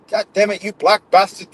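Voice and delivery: mock-furious, high, about to crack up; mock bile and anger. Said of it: "He said it with such anger".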